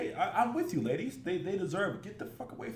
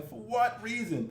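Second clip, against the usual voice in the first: ordinary talk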